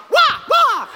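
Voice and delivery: two high loud karate yells; double karate shout. Shown as a demonstration. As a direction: kick it out twice. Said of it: "So it's like".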